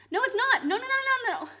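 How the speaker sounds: high, loud flurry of no's; heated denial. Real, not acted. Streamer rejecting a viewer's spelling claim, heated denial. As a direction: cut them off with high, insistent denials.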